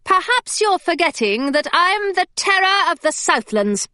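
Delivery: a plucky heroine standing her ground; Hilary, defiant. A narrator's reading. Hilary defying the pirate captain, proud and high.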